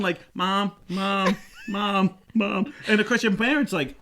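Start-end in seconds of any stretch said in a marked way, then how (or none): 0.3-2.7 put-on higher whiny call of a kid; kid calling mom.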